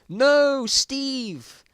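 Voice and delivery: high, loud cry of grief; anguished shout. A narrator's reading. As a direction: scream it as your friend falls. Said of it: "Shouted the bald man".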